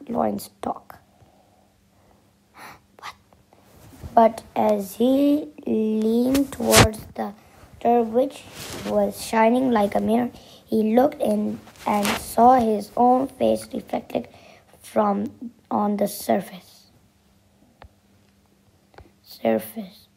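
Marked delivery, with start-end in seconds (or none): 0.1-3.1 whispered aside. Real, not acted. 19.2-20.1 whispered repeat of the word; just breathe it, no voice. Real, not acted.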